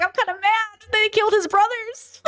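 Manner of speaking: high, whimpering fake sob; mock crying. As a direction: whine it like half-crying. Mock crying over dead brothers.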